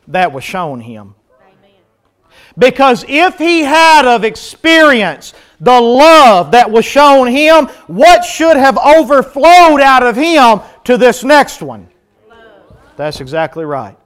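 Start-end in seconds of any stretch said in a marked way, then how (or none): 2.5-11.9 preaching climax. Real, not acted. Preacher rising to a point.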